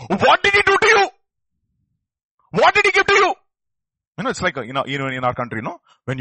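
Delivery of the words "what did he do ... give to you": loud, high demanding question; interrogating shout. Retold or imitated. Pharisees grilling the healed man, high and loud. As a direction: press him hard, much higher and loud. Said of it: "they said to him".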